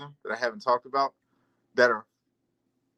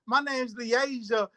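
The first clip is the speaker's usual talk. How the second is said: slow voiced Lily; 7 semitones higher, much slower than usual. Voicing Lily in story.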